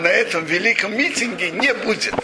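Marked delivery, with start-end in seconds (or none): none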